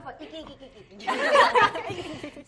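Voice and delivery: laughing through the words. Real, not acted. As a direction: giggle out the stumbling words. Laughing while stumbling on words.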